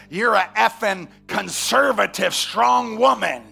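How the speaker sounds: put-on 1 blunt old man. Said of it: "looks at my wife out loud and says".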